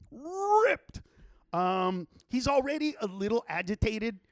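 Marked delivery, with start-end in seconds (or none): 0.1-1.0 RIPPED stretched out and pitched way up; drawn-out emphasis. Real, not acted. Friend marvelling at a man's muscles, RIPPED in high emphasis. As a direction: hit RIPPED high and hold it. Repeats the word for comic emphasis.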